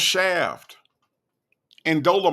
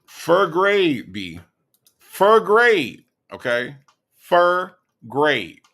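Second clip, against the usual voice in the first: slurred "Fur grade", louder and punched, mimicking his speech; mocking imitation; 4 dB louder, much slower than usual. Host mocks the jailed man's speech, slurred imitation. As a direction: parrot his mangled "Fur grade" back at him. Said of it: "sound like he talk".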